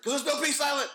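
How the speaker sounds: imitating an eager kid's blurt, high-pitched; blurted punchline. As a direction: jump in with the answer, pitched well up. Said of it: "He's like".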